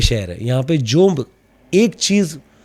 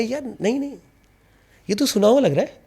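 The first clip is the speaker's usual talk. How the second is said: surprised realisation; 7 semitones higher, faster than usual. Retold or imitated. His suspicious reaction.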